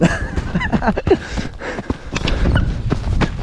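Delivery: high airy burst of laughter; breathless laugh. Real, not acted. Vlogger laughing as the puppies ambush him, high airy laugh.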